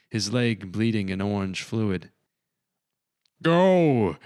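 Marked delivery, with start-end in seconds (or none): 3.4-4.2 a loud, high, roaring order; wounded warrior roaring at the crew to go; roared. A narrator's reading. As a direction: bellow it over the gunfire. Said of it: "he roared".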